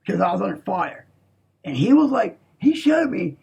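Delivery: ordinary delivery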